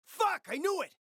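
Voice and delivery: exasperated yell when the plan fails; furious shout. Acted. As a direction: blow up, you saw this coming. Plan goes wrong, he explodes.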